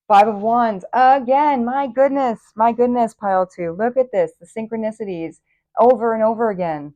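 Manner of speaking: delighted, can't contain it; excited. Real, not acted. Card repeats, reader thrilled.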